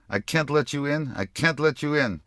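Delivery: put-on rapid, high Yiddish-accented old woman; fast, thick Yiddish grandmother refusing. A narrator's reading. Old lady at the door refusing entry, in thick Yiddish accent. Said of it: "she said in a Yiddish accent so thick, so rapid".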